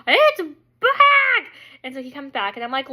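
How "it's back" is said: high, loud mock shriek; panicked scream. Wife alerting husband the bug returned, panicked shriek.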